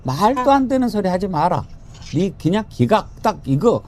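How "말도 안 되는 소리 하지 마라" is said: ordinary talk, nothing marked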